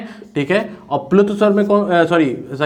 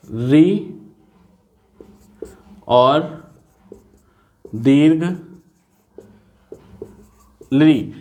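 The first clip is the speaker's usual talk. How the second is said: slow dictation with long pauses. Shown as a demonstration. Teacher dictating a note for students to copy. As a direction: break it into words for writing. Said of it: "लिखिए".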